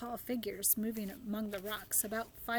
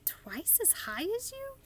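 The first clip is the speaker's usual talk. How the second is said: put-on much higher astonished girl; amazed cry; 11 semitones higher than usual. A narrator's reading. Sophie astonished at the giants' size, much higher cry. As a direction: squeak it in disbelief. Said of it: "cried Sophie".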